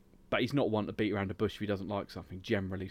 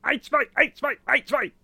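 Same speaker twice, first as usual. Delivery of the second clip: high, loud German counting; German accent; 13 semitones higher, 7 dB louder than usual. Retold or imitated. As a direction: bark the numbers like a German intro. Mimicking a German video intro.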